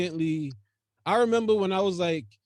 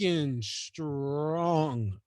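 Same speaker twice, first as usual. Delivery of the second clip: low, very slow and drawn out; slow, low emphasis; 5 semitones lower, 6 dB quieter, much slower than usual. Real, not acted. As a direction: stretch "strong" out, softer and lower. Repeats it, drawn out for weight.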